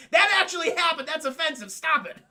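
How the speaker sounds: high, loud protest; imitated indignant yell; mock offended outburst. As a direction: object hotly, acting wounded. Offended actor answers dingo taunt.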